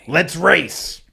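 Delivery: acted-out rallying yell; loud mock challenge. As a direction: belt it out as a race challenge. Repeats the retold race challenge.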